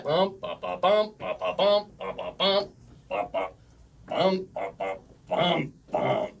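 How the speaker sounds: chanted "bum ba ba", long beat then two shorts; dactylic beat. Shown as a demonstration. Teacher demonstrating dactyls to the class, rhythmic "bum ba ba" chant. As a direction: pound out the hexameter on "bum, ba, ba". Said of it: "it's in something called a dactyl".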